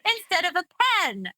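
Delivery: high, giggling through the words; laughing. Real, not acted. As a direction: laugh while explaining the contrast. Laughing through the pin pen demo.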